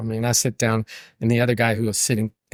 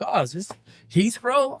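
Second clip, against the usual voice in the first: dazed confusion; 10 semitones higher than usual. Retold or imitated. Drunk self answering conductor.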